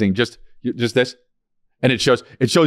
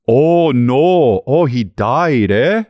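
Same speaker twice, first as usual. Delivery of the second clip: put-on drawn-out, singsong Minnesota accent; Fargo accent; 3 dB louder, much slower than usual. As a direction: stretch the vowels like a Fargo local. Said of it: "No, no, no, it's from Fargo".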